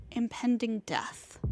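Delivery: ordinary delivery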